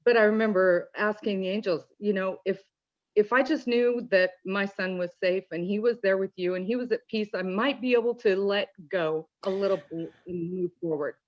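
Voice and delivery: ordinary talk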